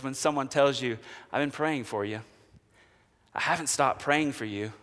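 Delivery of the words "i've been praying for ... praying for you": much softer and lower, gentle; tender prayer. Acted. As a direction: say it like a comfort to a friend.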